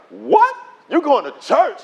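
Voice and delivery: high-pitched mock disbelief; incredulous. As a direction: act out a stunned friend. Friends react in disbelief.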